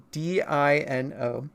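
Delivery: D I N O spelled slowly letter by letter. Shown as a demonstration. Streamer spelling for chat, slow letter by letter. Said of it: "I'll say the correct pronunciation".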